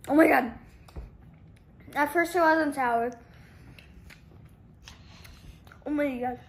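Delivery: loud gasped oh my god at the sourness; shocked loud reaction. Real, not acted. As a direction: react big to a very sour taste. First bite of very sour candy.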